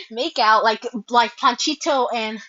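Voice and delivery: usual speaking voice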